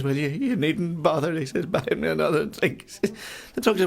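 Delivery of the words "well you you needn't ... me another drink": mournful drinker. Acted. Sad man replies.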